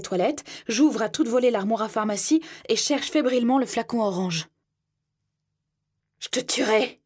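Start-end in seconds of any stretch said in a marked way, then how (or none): 6.2-7.0 mild voiced roar of rage; roared threat. A narrator's reading. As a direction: snarl the threat down the hallway. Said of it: "rugit-il dans le couloir".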